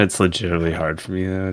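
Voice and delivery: ordinary talk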